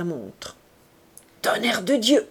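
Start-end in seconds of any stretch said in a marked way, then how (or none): 1.4-2.3 exasperated oath. A narrator's reading. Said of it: "cria-t-il".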